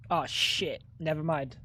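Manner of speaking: caught-out muttered retreat; sheepish. Real, not acted. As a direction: drop to a quiet, embarrassed mumble. Realizes gaffe, drops voice.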